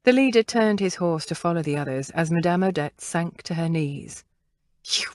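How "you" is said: dying, whispered spit of contempt; spat out weakly. A narrator's reading. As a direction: spend the last breath on it. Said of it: "she spat out with her last energy".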